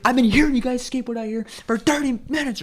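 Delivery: high, loud complaint; mock irate shouting; angry stranger yelling. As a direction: imitate a fed-up man ranting. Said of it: "You're fucking yelling at me first".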